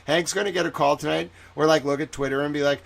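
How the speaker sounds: ordinary delivery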